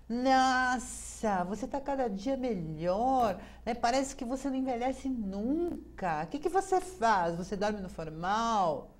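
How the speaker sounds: high, gushing mock flattery; butter up the boss; flattering the boss. Bajula o chefe. (bajulação exagerada ao chefe)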